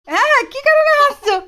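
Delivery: very high, loud teasing exclaims; playful amazement. Real, not acted. Mother teasing laughing toddler. (হাসতে থাকা বাচ্চাকে আদুরে মজার খুনসুটি)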